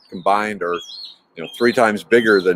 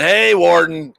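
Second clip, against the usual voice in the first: calling out; 6 semitones higher, 4 dB louder, slower than usual. Retold or imitated. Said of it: "old John comes out and says".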